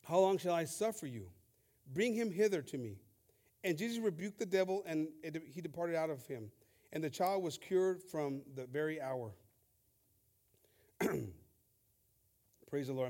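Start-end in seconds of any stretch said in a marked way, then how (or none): 0.0-9.3 a solemn scripture read. Acted. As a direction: read the verses with weight. Reading scripture aloud.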